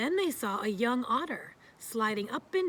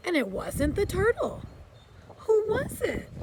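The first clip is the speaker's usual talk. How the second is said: higher and louder, puzzled; puzzled question; 9 semitones higher, 5 dB louder than usual. A narrator's reading. Little Beaver asking the wise old beaver, puzzled and high. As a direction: wonder aloud who it could be. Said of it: "he said".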